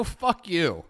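ordinary talk, nothing marked

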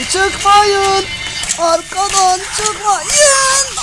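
high, slow, stretched panic cry; panicked drawn-out wail. Real, not acted. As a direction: wail the warning in terror. Panicked warning to teammates. (panikle uzatarak haykırış)

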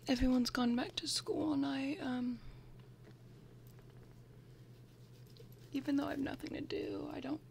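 soft breathy whisper, close to the mic; ASMR whisper. Real, not acted. Vlogger whispering through her bag contents, ASMR style. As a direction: whisper like sharing secrets with a friend.

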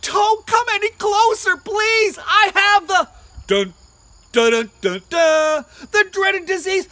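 mock scream. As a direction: plead loud with dramatic dun-dun. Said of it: "David wanted to scream".

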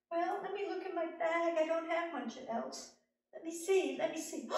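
high, musing helper voice; rummaging little man. Acted. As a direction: search your bag aloud. Little man searches his bag.

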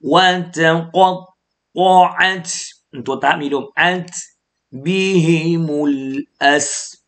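slow, drawn-out chanted recitation; Quran recitation. Shown as a demonstration. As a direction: recite the verse melodically for the listeners. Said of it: "ผมจะอ่านตรงนี้ให้พี่น้องฟัง". (อ่านทำนองกุรอานช้าๆ ให้พี่น้องฟัง)

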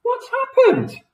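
very high, drawn-out shriek; screamed. A narrator's reading. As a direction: scream it in Missus Twit's shocked high voice. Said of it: "screamed Missus Twit".

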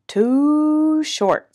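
high, mooing "too"; storybook cow with a drawn-out vowel. A narrator's reading. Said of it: "said Cow".

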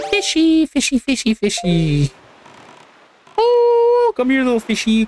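put-on high coaxing baby talk; sing-song call to a pet; talking to fish.

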